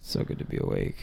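very low, breathy, groggy mock greeting; morning voice. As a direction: drag it out, barely awake. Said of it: "morning voice".

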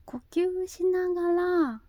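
usual speaking voice

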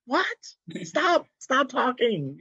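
high, loud incredulous outburst; hammed-up high-pitched disbelief; mock indignation. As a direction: act out being utterly baffled and offended. Acted indignant comeback to family.

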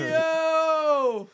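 loud, high excited yell; excited shout. Real, not acted. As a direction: shout it out in delight. Reaction after the laughter.

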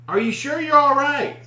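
high, loud call; re-enacted scream. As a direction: holler again to someone out of sight. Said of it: "I screamed".

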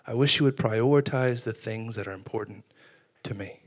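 soft, low, hushed voice; near-whispered tender plea, still voiced; gentle whisper, imitated. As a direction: say it softly, as God quietly appealing. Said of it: "He would whisper to us".

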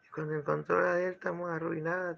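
normal talking voice

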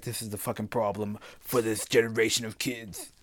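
lightly voiced old politician; a hint of a stern politician; Joe Biden parody. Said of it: "That's not how he talks. I'm parodizing".

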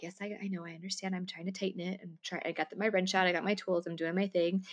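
quiet, forced-calm reply; keeping my cool. Retold or imitated. Answering her nervous mother while fixing the washer, forced calm and quiet. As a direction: hold it together, very softly. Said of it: "I'm trying to keep my cool".

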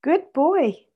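put-on high, cheerful toddler voice; cheerful little boy. A narrator's reading. Freddie echoing his boarding mother, cheerful and childlike. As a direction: chirp it like a happy small child. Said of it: "said Freddie cheerfully".

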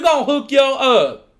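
high, hammed-up Southern sales pitch; put-on Southern accent. Mock Southern official pitching the raffle.

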